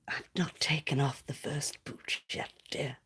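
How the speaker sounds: whispered teasing to a caller; mock sex-line operator whispering; sultry whisper. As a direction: purr it low and secret. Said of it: "in that kind of voice".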